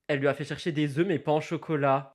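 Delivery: normal talking voice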